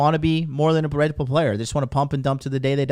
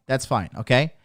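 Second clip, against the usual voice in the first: usual speaking voice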